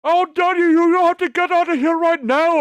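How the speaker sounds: put-on very high, loud accented yelling; hammed-up accented rant; mock Indian accent shout. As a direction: scream it like the angry clerk with a bad Indian accent. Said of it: "he was like".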